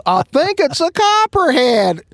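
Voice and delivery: high, lightly voiced dazed suspect; mock matter-of-fact suspect. Bitten meth head calmly tells chief it's a copperhead. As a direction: play the bitten man calmly naming the snake. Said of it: "And he goes".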